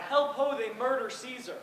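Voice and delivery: high, loud cry for help; cried out. Retold or imitated. Caesar retelling Calpurnia's cry in her sleep, loud and high. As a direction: cry out as if from a nightmare. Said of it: "in her sleep cried out".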